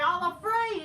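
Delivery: lightly hammed-up fearful whine; mock scared whine. Mocking Sami Zayn's doubts again, mild scared whine. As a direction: mimic a timid coward. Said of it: "Sami Zayn".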